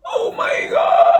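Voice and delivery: very high, loud, drawn-out yelp; pained cry. Real, not acted. Just poked own eye, real high pained cry. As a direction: wail it slowly in sudden pain.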